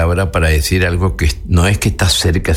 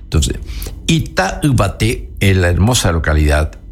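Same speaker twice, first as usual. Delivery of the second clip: normal talking voice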